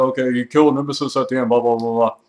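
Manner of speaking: usual speaking voice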